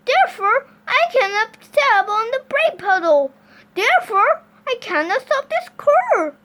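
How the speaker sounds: high, loud, helpless explanation; alarmed Mister Watson. Acted. Driver explains he cannot stop, high loud helpless voice. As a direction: protest loudly that you are stuck.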